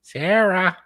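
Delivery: high-pitched parrot imitation; parrot accent. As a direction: cry the name out as a parrot would. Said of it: "I was doing it in a parrot accent".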